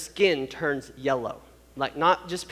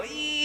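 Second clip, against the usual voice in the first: imitated high, soft squeal of pain; put-on strained "ee"; tight pained "ee"; 10 semitones higher, 10 dB quieter than usual. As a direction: mimic tensing up with a high "eee". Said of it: "when they're in pain is they go".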